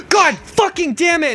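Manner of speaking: high, mock furious outburst; losing it. Student cursing in class at his phone, mock outburst. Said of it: "just lose his shit".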